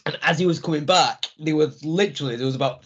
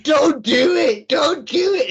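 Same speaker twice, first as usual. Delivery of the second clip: much higher, loud wailing plea, slowed; panto crowd cry; 12 semitones higher, 4 dB louder, much slower than usual. Retold or imitated. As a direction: howl it like a pantomime audience. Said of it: "was Panto".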